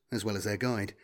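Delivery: plain reading voice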